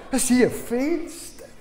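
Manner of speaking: high, slow, wide-eyed playing dumb; feigned innocence. Boy to his father, high slow fake innocence. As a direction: act as if you never saw a window.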